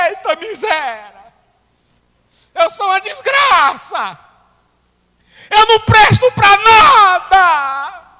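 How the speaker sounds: high weeping wail building to a shout; wailing sinners. Retold or imitated. Preacher voicing weeping people, crying out in despair. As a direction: cry out like a sinner broken by the Word.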